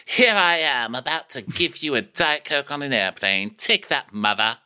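high, sassy mock model voice. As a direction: pose like a nineties stewardess. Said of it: "she's like a model".

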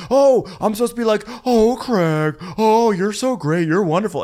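high, loud sarcastic gush; mocking sarcasm. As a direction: gush fake praise. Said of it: "[name] starts mocking".

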